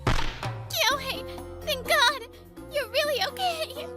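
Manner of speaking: high-pitched, tearful-sounding outburst of relief; overwhelmed relief. Acted. Ex-girlfriend running up to find him safe, overwhelmed relief. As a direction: cry out as if he was nearly lost.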